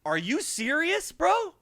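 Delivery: slow, rising disbelief; incredulous. Retold or imitated. Narrator stunned at the driver, a slow rise. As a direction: ask it slowly, stunned. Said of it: "And I just go".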